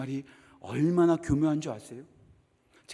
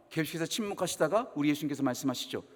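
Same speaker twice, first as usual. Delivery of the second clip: normal talking voice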